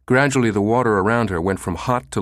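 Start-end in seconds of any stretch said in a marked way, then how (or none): none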